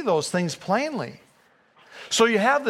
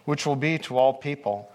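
measured, lower reading voice for the Gospel; lower, unhurried Bible reading; scripture reading; 4 semitones lower than usual. Acted. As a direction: read it out solemnly from the pulpit. Reads the Christmas story aloud.

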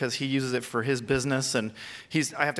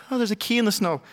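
high, louder gasp of discovery; surprised discovery; 7 semitones higher, 4 dB louder, faster than usual. Retold or imitated. Finding the lost key in the snow, a high surprised exclaim. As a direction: replay the moment the key shows up. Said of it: "and I went".

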